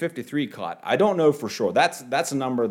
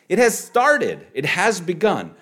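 excited proclamation; 9 semitones higher, 5 dB louder than usual. Real, not acted. Preacher proclaims new life started.

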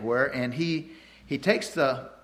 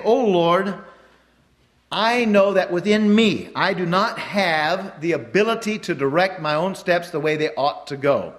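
louder, solemn prayer voice; 2 semitones higher, 4 dB louder than usual. Acted. Preacher voicing the verse as a prayer to God, solemn and loud. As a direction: plead upward to the Lord.

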